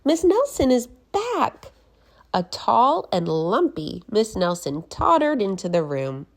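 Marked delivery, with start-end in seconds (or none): 0.0-1.7 put-on high excited kids' voice, far from the narrator; kids' chorus. Class announces the teacher's return, high and excited. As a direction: squeal it like a class of kids. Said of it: "said the class".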